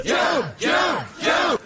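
high, sharp call across the room. Real, not acted. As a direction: call his name to get attention. Calling out to a colleague.

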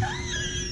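high shrieking laugh. Real, not acted. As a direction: burst out into a squeal. Big laugh.

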